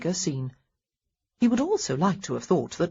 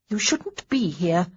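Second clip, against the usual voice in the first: stern warning; slower than usual. Acted. Honoria scolds Marcus.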